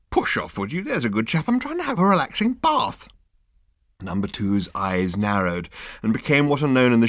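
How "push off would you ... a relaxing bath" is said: a hint of a languid, dismissive captain; captain shooing his officer; lazy captain. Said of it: "captain softly".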